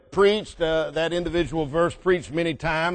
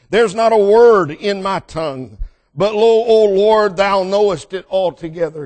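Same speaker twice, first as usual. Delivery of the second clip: preaching the psalm; 3 semitones higher, 6 dB louder than usual. Acted. Preacher reading the psalm aloud to the church, ringing. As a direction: proclaim the verse to the congregation.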